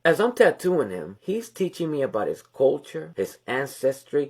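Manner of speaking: normal talking voice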